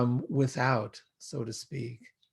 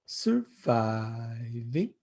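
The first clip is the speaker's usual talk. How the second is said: slow drawn-out surviving with a lift at the end; three-syllable sing-song; 2 semitones lower, much slower than usual. Retold or imitated. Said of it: "in three syllables".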